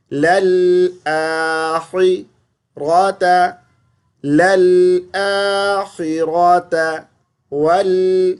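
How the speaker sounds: للآخرة slow and melodic; correct recitation. Shown as a demonstration. Teacher models للآخرة slowly. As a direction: recite للآخرة properly, drawn out.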